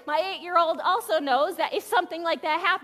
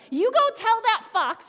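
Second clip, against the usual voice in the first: sassy and louder, leaning on go; a little sass; 5 semitones higher, 6 dB louder than usual. Retold or imitated. Jesus sending word back to Herod, sassy. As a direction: toss it off with cheeky sass. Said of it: "I imagine a little bit of sass".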